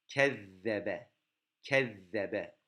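Kezzebe with the zz said as a lisped th, tongue against the teeth. Shown as a demonstration. Teacher models Kezzebe for students, zz lisped against the teeth. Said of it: "ön dişlerinizin üst tarafına vuruyoruz".

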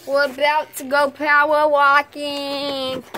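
slow, loud hollered announcement; play-acted slow holler; drawn-out announcement. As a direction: stretch out the words as you call. Announcing the power walk, drawn out.

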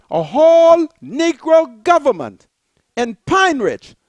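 ordinary talk

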